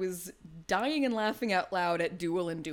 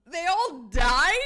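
slow, loud laughing disbelief; stunned and cracking up; laughing shock; 7 semitones higher, 8 dB louder, slower than usual. Real, not acted. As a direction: laugh through the question. Shocked at missed ending, laughing.

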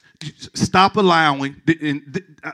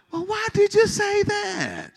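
slow, very high mock-offended spouse; high-pitched protest; slower than usual. Spouse answering a complaint about the greens, high-pitched protest. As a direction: stretch it out in a high, put-on voice. Acted quarrel between spouses.

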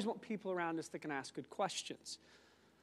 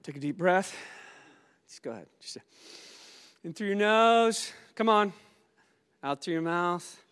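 guided breathing; 3 semitones lower than usual. Acted. Leads a breathing exercise.

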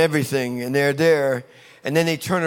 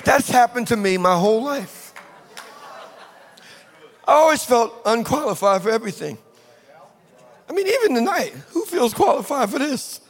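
high, ringing preacher's delivery; impassioned preaching; 8 semitones higher than usual. Real, not acted. Preacher telling church God will catapult them, impassioned. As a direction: drive it home to the congregation, pitch raised.

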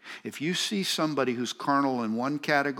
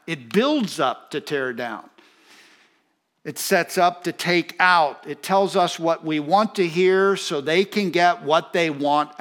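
raised, higher voice with heavy emphasis; forceful preaching; 5 semitones higher, 7 dB louder than usual. Real, not acted. Preacher warning congregation how flattery works, forceful preaching. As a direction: drive each point home from the pulpit.

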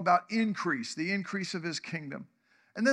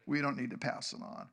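softer, lower repeat sinking in; quiet echo; 6 semitones lower, 7 dB quieter than usual. Shown as a demonstration. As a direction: let it land quietly the second time. Repeated for emphasis.

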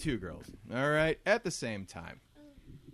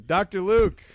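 loud announcer call of the segment name; 5 semitones higher, 12 dB louder, slower than usual. Shown as a demonstration. Host loudly announces the Doctor Luke segment, announcer style. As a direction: boom it out like a show host. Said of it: "Doctor Luke is a segment".

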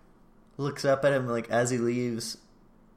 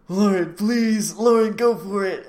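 pleading; 8 semitones higher, 8 dB louder than usual. Retold or imitated. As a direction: beg them desperately from the sidelines. Viewer begging the couple on screen.

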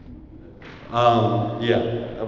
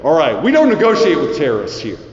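loud fast slogan; 11 dB louder, faster than usual. Acted.

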